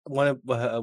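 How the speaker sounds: ordinary talk, nothing marked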